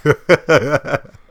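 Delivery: loud rising laugh at the joke; a real, high, loud laugh; burst of laughter. Real, not acted. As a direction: crack up at the punchline. Friend's Northeast joke.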